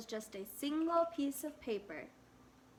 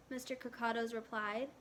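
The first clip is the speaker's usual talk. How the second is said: ordinary delivery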